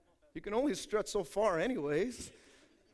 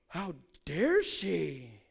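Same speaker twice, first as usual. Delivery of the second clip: scandalized churchgoer; 4 semitones lower, much slower than usual. Retold or imitated. Mocking a judgmental churchgoer.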